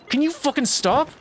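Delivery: high-pitched annoyed plea at the game; fed up, begging it to quit it; exasperated. Real, not acted. Yelling at game, frustrated.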